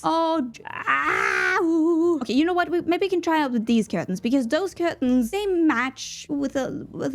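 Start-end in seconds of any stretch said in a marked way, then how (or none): 0.0-2.2 mock cry. Real, not acted. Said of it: "I'm gonna cry".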